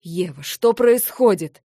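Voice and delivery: loud, slow barked question; barking. Acted. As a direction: bark it out, demanding. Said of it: "рявкнул он".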